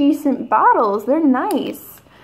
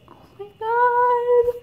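awed gasp; 11 semitones higher, slower than usual. Real, not acted. Gushing over the cards.

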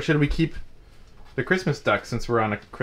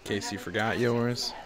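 normal talking voice

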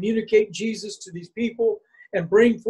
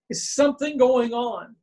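ordinary talk, nothing marked

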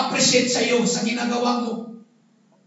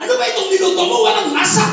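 complaining husband's mock yell, louder; angry husband snapping about the food; 5 semitones higher, 5 dB louder, much faster than usual. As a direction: bark the complaint at the wife. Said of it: "sumigaw yung lalaki".

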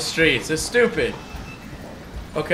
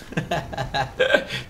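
low, soft run of chuckles; amused chuckle; 5 semitones lower, 8 dB quieter than usual. Real, not acted. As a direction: chuckle quietly to yourself. Laughs after the outburst.

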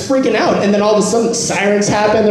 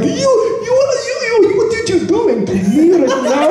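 high-pitched, sputtering indignant impression; mock pissed-off driver; 13 semitones higher than usual. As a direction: sputter in comic outrage, pitched way up. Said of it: "he's all pissed off".

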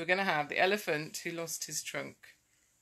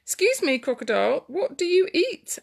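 high, lilting polite question; 8 semitones higher, 5 dB louder than usual. A narrator's reading. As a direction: ask politely as a little elephant. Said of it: "Elephant asked politely".

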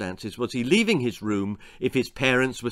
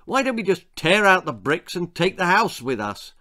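exasperated outburst; 6 semitones higher, 4 dB louder than usual. A narrator's reading. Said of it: "exploded Simon's mum".

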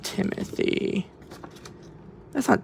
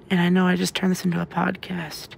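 normal talking voice

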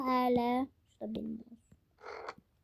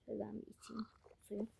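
whispering; 2 semitones lower, 16 dB quieter, much faster than usual. Real, not acted. Whispers aside.

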